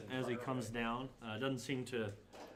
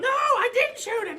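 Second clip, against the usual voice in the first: very high, very loud goblin denial; indignant goblin shriek; 13 dB louder than usual. Acted. Goblin denying threat-worthy shot, indignant shriek. Goblin denies again, louder.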